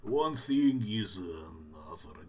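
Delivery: hammed-up Russian character accent; comic Russian accent. Shown as a demonstration. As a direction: play a silly Russian character. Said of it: "now I speak Russian".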